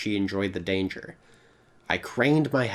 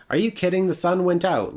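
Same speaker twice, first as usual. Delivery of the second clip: higher-pitched acted disbelief; incredulous; 9 semitones higher, 4 dB louder than usual. Passenger to driver after the sun went out, incredulous. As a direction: protest in disbelief. Narrator acts incredulous reply.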